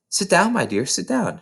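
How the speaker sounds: kindly invitation. A narrator's reading. Miss Honey welcoming Matilda kindly.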